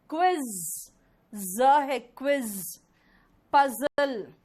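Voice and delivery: क्विज and पजल with a long buzzing z; emphatic word drill, ज said as z; z sound demonstrated. Shown as a demonstration. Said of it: "क्विज नहीं होता".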